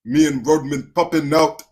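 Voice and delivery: loud mock British roadman voice; deep-voiced British accent. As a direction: boom it out as a British roadman caricature. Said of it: "get the deep voice with the British accent".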